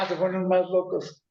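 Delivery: usual speaking voice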